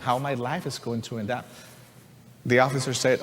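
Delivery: ordinary talk, nothing marked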